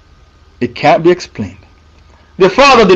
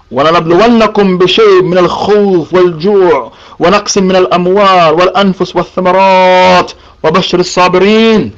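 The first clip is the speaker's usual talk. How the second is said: fast, rhythmic scripture recitation; Quran recitation; much faster than usual. Acted. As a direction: run through the verse as a practiced recital. Recites Quran verse in Arabic.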